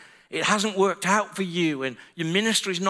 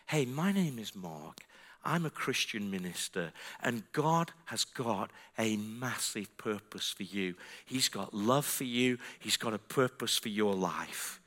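low, soft and unhurried pastoral voice; gentle reassurance; 6 semitones lower, 8 dB quieter than usual. Retold or imitated. Minister to a crying girl, gently reassuring. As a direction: speak softly and low, as if comforting.

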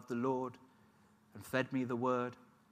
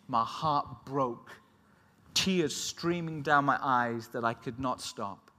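usual speaking voice

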